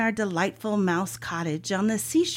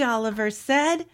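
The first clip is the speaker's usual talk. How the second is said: ordinary delivery, nothing marked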